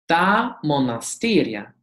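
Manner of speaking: normal talking voice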